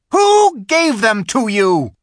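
high, loud contemptuous order; witch's command. A narrator's reading. Witch demanding the silent animals answer. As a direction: spit the insult down at them. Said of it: "said the witch".

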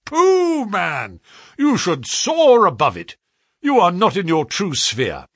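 scoffing agent. A narrator's reading. Agent brushes off the clerk's modesty, scoffing loudly. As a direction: wave the modesty away.

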